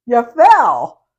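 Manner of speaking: high, loud exclaim of the obvious; mock exclaim. Teacher voicing a child: a high, loud blurt.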